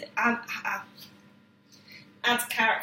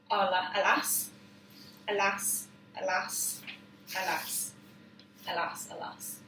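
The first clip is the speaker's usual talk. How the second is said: each alas softer, ending in a whisper; fading alas; 5 dB quieter than usual. Shown as a demonstration. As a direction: let each alas die away. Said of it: "and then I say".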